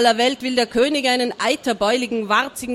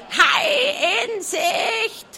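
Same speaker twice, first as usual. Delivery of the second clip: very high, slow screeched crow; crowing hen shout; 12 semitones higher, much slower than usual. A narrator's reading. The hen screeching the warning from the crow's nest. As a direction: crow it out at the top of the lungs. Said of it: "krähte aus voller Kehle".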